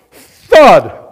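loud imitated thud; mock thud. Preacher imitating a buddy hitting the ice, loud thud. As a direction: shout the crash sound for laughs. Buddy slipping on icy road.

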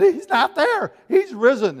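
high, loud breathless-news cry; excited women. Retold or imitated. Women report the empty tomb.

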